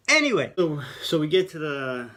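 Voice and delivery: bursts back in loud and bright, high; loud reset. Real, not acted. After apologising to viewers, a loud high restart.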